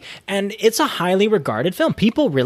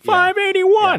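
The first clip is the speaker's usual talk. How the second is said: high, drawn-out order call; 14 semitones higher, 3 dB louder, much slower than usual. Retold or imitated. Counter worker announcing chicken tenders order, calling out. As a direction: sing out the number across the room. Order caller shouting out numbers.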